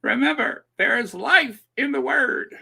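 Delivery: high, emphatic preacher impression; mock televangelist pitched well up; Joyce Myers. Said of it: "I used to do a mean Joyce Myers impersonation".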